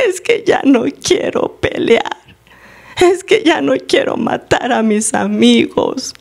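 put-on slow, high, plaintive little-child voice; childlike voice. Huge slave answers the master, pleading like a child. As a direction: imitate a defenseless child pleading. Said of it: "el otro responde como un niño".

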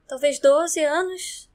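ordinary talk, nothing marked

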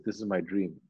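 low, soft retold lament. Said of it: "he's lamenting after".

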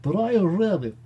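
high, loud comic swoop on the name; playful, exaggerated high-pitched call; silly put-on voice. Repeats the name in funny voice.

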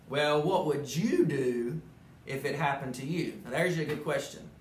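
ordinary delivery

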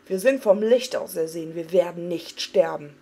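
rally them with fury; harsh and wrathful. A narrator's reading. Arthas rallying his frightened men, harsh and furious. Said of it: "sondern barsch und zorngeladen".

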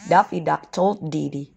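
ordinary delivery

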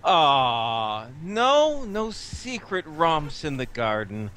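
low, slow mock disappointment; sarcastic retort. In-character retort to the baroness.

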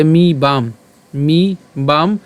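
"মি বাম" slowly, stopping on the m; split at final m. Shown as a demonstration. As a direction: separate "মি বাম" into two drawn-out parts. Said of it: "এই ম পর্যন্ত আমরা উচ্চারণ করছি". ("মি বাম" টেনে, ম-এ থেমে)

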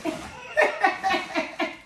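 high delighted laugh; giddy laugh. Real, not acted. Giddy over a heavy gift.

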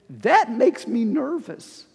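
ordinary talk, nothing marked

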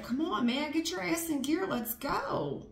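high, pushy hurry-up voice; a partner pushing for action; impatient pusher. Acted. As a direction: urge them on, mock impatient. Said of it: "And it's like".

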